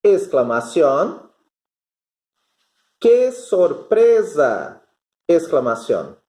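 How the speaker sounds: dictating aloud with the punctuation named; dictation demo. Shown as a demonstration. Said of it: "eu teria que ditar assim".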